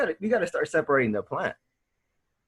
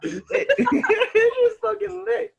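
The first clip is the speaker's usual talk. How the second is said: slow words through high laughter; cracking up while answering his friend; laughing; 6 dB louder, much slower than usual. Real, not acted. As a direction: laugh out the words as you say them. Laughing reply to Lucifer story.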